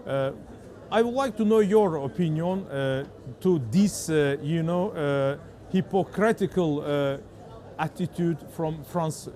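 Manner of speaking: English with a strong Azerbaijani accent. Real, not acted. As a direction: speak English the way a Baku reporter does. Interviewer asks about France.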